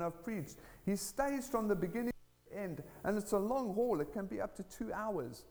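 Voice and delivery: high-pitched, emphatic testimony; impassioned preaching. Real, not acted. Telling church about an atheist proclaiming Jesus, impassioned preaching.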